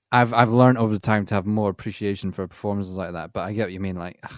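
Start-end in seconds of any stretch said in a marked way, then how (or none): none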